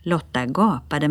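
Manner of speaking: ordinary delivery, nothing marked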